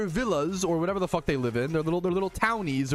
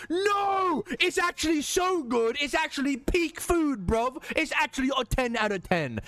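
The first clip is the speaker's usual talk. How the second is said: indignant mock British; 8 semitones higher than usual. Mimicking British people defending beans, indignant British accent. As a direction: protest like an outraged Brit. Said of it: "British people go".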